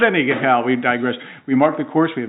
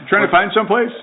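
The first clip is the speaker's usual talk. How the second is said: high, louder busybody question; mock interrogation, pitched up; nosy neighbor; 9 semitones higher, 5 dB louder, faster than usual. Imitating neighborhood watch grilling runners, high nosy voice.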